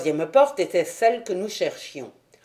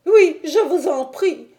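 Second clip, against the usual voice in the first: high, loud desperate plea; a frightened man imploring Holmes to lead; pleading; 11 semitones higher, 6 dB louder than usual. Acted. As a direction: beg him urgently. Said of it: "supplia Thaddeus Sholto".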